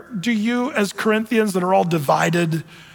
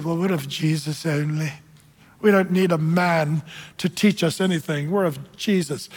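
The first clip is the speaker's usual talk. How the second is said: lightly voiced pious believer, pitched down; mock holy people; 3 semitones lower than usual. Preacher mimics the holy faction claiming Jesus only, mildly pious. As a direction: put on a mild holier-than-thou voice. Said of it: "The holy people said".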